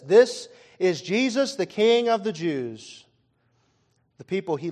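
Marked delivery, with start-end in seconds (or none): 0.0-3.0 slow, loud announcement of the sign's words; slow proclaimed reading. Shown as a demonstration. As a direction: read the sign out slowly and loudly. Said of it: "it says".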